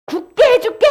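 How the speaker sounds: loud, high declaration; emphatic promise. Acted. God promising to make Ezekiel firm, loud declaration. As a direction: proclaim the promise with force.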